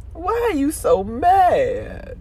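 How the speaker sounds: high, slow, drawn-out put-on voice. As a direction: stretch it out slowly, mocking. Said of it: "that's [name] on that song".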